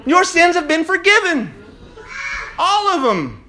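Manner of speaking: loud, high rallying preaching; fervent preaching. Real, not acted. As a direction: fire up the congregation. Preaching at full force.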